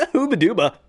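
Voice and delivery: Sonic catchphrase. Retold or imitated. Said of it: "shout out to Belina Productions".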